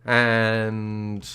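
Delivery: drawn-out announcer outro; slow grand sign-off. Acted. Reader closing the Frankenstein episode, slow and grand. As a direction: stretch every word like a host. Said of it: "slow mock-grand outro".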